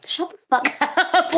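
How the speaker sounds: high, slow retort breaking into laughter; mock exasperation, cracking up mid-phrase; laughing retort. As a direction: pretend to snap back, laughing. Joking retort to pitying onlookers.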